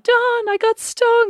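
high, slow, crying out for husband; retold crying call. Wife crying out to husband after a wasp sting, high wailing. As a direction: wail his name through fake tears. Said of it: "And I was crying".

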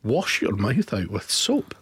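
low, slow mock-stern telling-off; mock scolding. Host scolds co-host for mentioning rugby, mock-stern and low. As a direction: play the outraged parent, low and slow.

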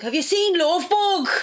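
put-on high, slow little-boy Dublin accent; piercing Dublin accent. Little boy in a play asking about a film, piercing Dublin accent. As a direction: imitate a tiny kid's piercing Dublin accent. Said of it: "this really piercing Dublin accent".

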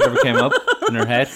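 fast, high, laughing through it; laughing while talking. Real, not acted. Laughing recalling friend's antics.

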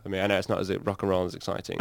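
ordinary talk

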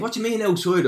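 high-pitched incredulous question; baffled mate. Retold or imitated. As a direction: imitate a friend's disbelief, pitch right up.

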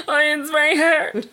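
hammed-up tearful whine, half laughing; mock crying. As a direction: pretend to sob through the complaint. Joking about failing at life.

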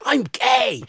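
high, loud shout over pumping music; retold scream. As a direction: yell it out over the club music.

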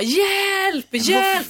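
two high imitated cries for help; high, drawn-out retold scream. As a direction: mimic someone crying out for help. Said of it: "någon som skriker på hjälp".

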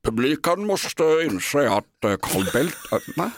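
half-hearted, badly faked statesman voice; Carl Bildt. Shown as a demonstration. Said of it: "berätta vad som hände sist jag gjorde Carl Bildt".

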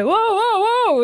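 high-pitched mock alarm; overreacting scare. Passer-by recoils from a little dog, imitated overreaction. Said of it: "liksom helt overreagert på den lille hunden".

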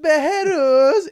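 high, loud Behruz call. Retold or imitated. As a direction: call the name out across the street. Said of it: "han brukar alltid säga så här". (högt utrop Behruz)

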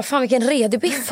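high-pitched Småland dialect quote. Acted. Girl back from Växjö talks to mum, imitated Småland dialect. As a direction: put on Småland dialect, voice lifted well up. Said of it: "då snackade jag typ småländska".

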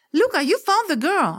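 high-pitched delighted exclamation; exclaiming. A narrator's reading. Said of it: "αναφωνεί". (αναφώνηση με ψηλή φωνή)

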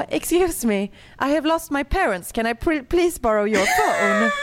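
high, pleading little-girl voice, imitating; a grown woman acting like a lost kid; lost child voice. Ber främling om telefon, barnslig. (härmad ynklig barnröst, ljus och bedjande)

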